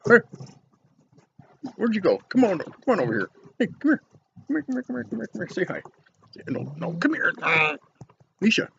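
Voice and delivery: high coaxing pet voice; coaxing the dog. Real, not acted. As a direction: sweet-talk the dog to come over. Coaxing German Shepherd to camera.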